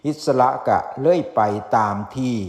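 usual speaking voice

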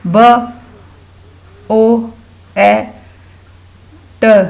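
ब, ओ, ए, ट as isolated sounds; sounding out letters. Shown as a demonstration. Teacher helping pupils spell boat, sounding out letters. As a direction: give ब, ओ, ए, ट one at a time with long gaps. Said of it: "बोट को लिखने की कोशिश करें".

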